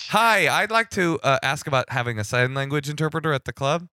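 high, loud opening, bright-eyed request; interpreted caller voice. Pretend. Sign language caller to comedy club, high put-on voice.

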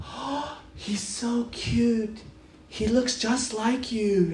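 gushing church lady. Retold or imitated. Church member complimenting the baby, slow sweet coo. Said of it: "people would come ... be like".

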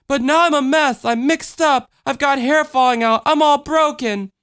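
put-on high-pitched little-girl outburst; small child wailing complaints; distressed child. Voices the child.

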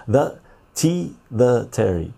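slow, deliberate tongue twister; first careful run at the tongue twister; slow careful try. Shown as a demonstration. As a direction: feel out each sound slowly. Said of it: "say that ten times fast".

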